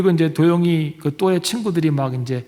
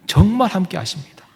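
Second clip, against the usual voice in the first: ordinary delivery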